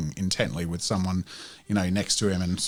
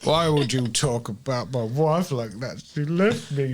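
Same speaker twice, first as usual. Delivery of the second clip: mock wounded drunk; 4 semitones higher than usual. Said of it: "he walks away. He's like, starts crying".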